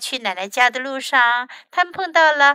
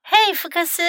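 high-pitched motherly holler; calling out; 7 semitones higher than usual. A narrator's reading. Mom calls hiding Fox, high calling voice. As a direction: call over to your child. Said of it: "妈妈叫道".

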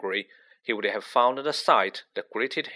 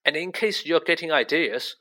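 ordinary delivery

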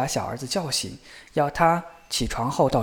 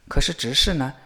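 plain reading voice

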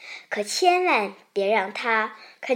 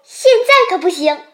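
loud, high, excited refusal; excited shout; 8 semitones higher, 11 dB louder than usual. A narrator's reading. The rabbit refuses to let Xiaopang go home, shouting excitedly. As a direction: cry out in keyed-up protest. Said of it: "兔子激动地大叫".

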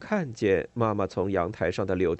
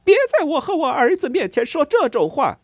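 high, loud, angry shout; furious yell; 10 dB louder than usual. A narrator's reading. Mother yelling at the neighbour in rage. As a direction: scream her down. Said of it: "我妈妈冲她继续吼".

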